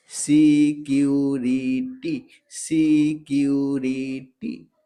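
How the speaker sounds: letters ऐसी सी, सी यू की, आर आई री, T Y T, then सिक्योरिटी stretched; spelled out slowly. Shown as a demonstration. Teacher demonstrating spelling सिक्योरिटी for the class, slow and careful. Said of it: "पढ़ो".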